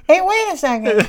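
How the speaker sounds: high squeaky pig voice; voicing Babe. Babe calling after the monkey, squeaky and high. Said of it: "He's like".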